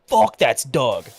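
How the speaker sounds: slow drawn-out Fuck that's Doug; mock drawled catchphrase; friend's catchphrase. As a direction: drag it out like the buddy. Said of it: "he'll go".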